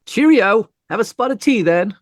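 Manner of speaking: high, quick, chirpy mock-posh English goodbye; mock British cheer. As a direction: breezily bid them cheerio like a caricature Englishman. Mock jolly British goodbye when arrested.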